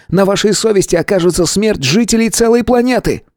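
high, overwrought voice rising to a near shriek; a paranoid man losing his grip; frantic earthling. A narrator's reading. Earthling accuses the stranger of mocking him, frantic and high. Earthling threatens to leave, agitated.

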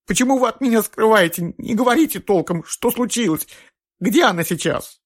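high, panicked pleading; distraught. A narrator's reading. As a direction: beg for the truth in panic. Distraught mother demands answers.

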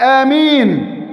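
آمين called out, drawn long with a falling end; loud amen. Retold or imitated. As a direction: call out آمين like from the pulpit. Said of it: "قال بصوت عال". (آمين بصوت عالٍ ممدود)

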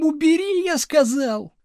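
high, frantic order; yelled demand. A narrator's reading. Pupil pulled by the ear, yelling at the teacher. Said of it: "заорал Градусов".

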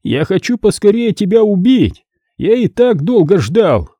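high, malicious threatening voice; menacing caller. A narrator's reading. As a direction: gloat over the coming kill, pitched high. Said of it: "В голосе звучала злость". (злорадно и угрожающе, высоким голосом)

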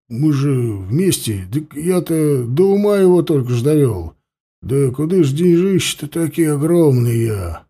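stunned craftsman, mumbling in disbelief; lightly voiced flustered old craftsman; dazed mumbling. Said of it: "ошалело стоял и бормотал".

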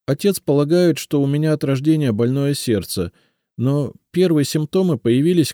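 тонкий девичий голос, с надеждой; Елизавета, робкая девушка. A narrator's reading. Elizaveta tells illness. (голос повыше, робкая просьба девушки)

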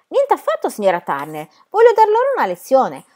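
high, loud defiance; indignant, fighting outburst; heated refusal. A narrator's reading. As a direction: refuse hotly, voice leaping up. Fighter refuses to stop brawling.

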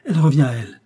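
loud cry of relief; relieved exclamation. A narrator's reading. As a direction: exclaim as hope returns. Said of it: "s'écria le jeune homme". (exclamation soulagée, forte)

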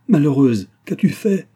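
loud, high cry of horror; cri horrifié. A narrator's reading. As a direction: cry out aghast at your daughter. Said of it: "et poussa un cri". (exclamation affolée, aiguë et forte)

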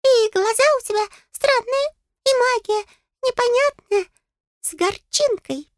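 very high, measured little voice; high-pitched suspicious fairy; wary fairy. A narrator's reading. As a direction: size her up in a squeaky voice. Wary fairy sizing her up.

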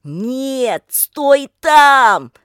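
loud, high long-distance call; distant shout. A narrator's reading. Pavel warning Lika from the lake, drawn far-off shout. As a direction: call it out across the water. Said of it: "раздался приглушённый расстоянием его голос".

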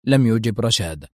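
ordinary delivery, nothing marked

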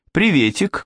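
very high, chirpy greeting; girl calling out. A narrator's reading. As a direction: call hello as a young girl. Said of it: "крикнула девочка". (звонкий оклик девочки)

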